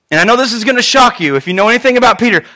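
ordinary talk, nothing marked

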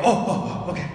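startled oh, high and loud; taken aback realization; surprised. Retold or imitated. Imitating surprised stranger.

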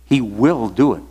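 "will" stretched and punched; firm emphasis landing on "will"; stress on will. Shown as a demonstration. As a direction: lean hard on "will". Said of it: "I'm emphasizing the word will".

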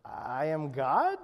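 low, slow, unsure question; hesitant, half-hearted. Acted. Shirley trying the declaration at the ocean's edge, hesitant and half-hearted. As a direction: say it haltingly, rising into a question. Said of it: "kind of hesitantly, half-heartedly".

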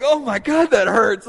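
loud anguished groan of pain; pained exclaim. Retold or imitated. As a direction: let the sting hurt out loud. In pain after eye sting.

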